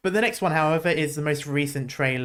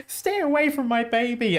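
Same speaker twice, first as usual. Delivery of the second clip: lightly voiced higher-pitched woman; imitated woman; 8 semitones higher than usual. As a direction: quote a mother's warning, pitched up high. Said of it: "the woman's like".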